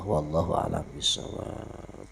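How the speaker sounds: low, soft prayer recitation; soft murmured Arabic prayer; quiet closing prayer. Real, not acted.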